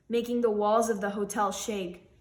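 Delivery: ordinary delivery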